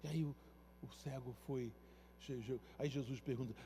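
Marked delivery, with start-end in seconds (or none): none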